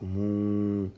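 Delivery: "mu," with the vowel held very long, like ou; "mu," as one steady sustained syllable; lengthened U. Shown as a demonstration. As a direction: draw out the ou sound of "mu,". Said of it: "le w qui sert d'outil d'allongement".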